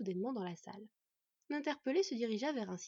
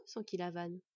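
plain reading voice

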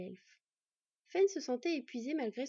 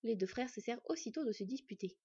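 ordinary delivery

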